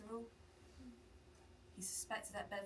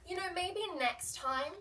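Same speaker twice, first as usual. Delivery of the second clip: condescending snap; 6 semitones higher, 6 dB louder, slower than usual. Retold or imitated. Customer insults barista.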